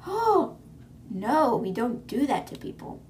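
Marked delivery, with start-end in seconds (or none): 0.1-0.6 high gasp then oh. Acted. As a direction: gasp in mock shock. Gasp before the quote.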